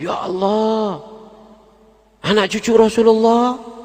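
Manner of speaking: high, drawn-out cry of awe. Acted. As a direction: cry out to God in shock. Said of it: "Jerit Abdullah bin Mubarak". (teriakan tinggi penuh kaget)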